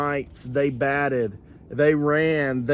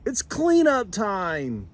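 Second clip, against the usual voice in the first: high, raised call to the team; Kevin yelling; 10 semitones higher than usual. Acted. Kevin calling his team to clean up, raised high voice. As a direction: call the mice to work. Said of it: "Kevin yelled".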